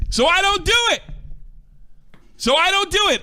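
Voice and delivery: ordinary talk, nothing marked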